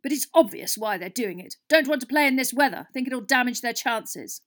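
furious. A narrator's reading. Captain explaining the rival team's dodge, furious. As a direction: rant through gritted teeth. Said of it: "grinding his teeth furiously".

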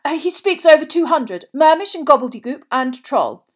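high, loud and eager; excited. A narrator's reading. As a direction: gush admiringly, voice pitched well up. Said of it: "writhing with excitement".

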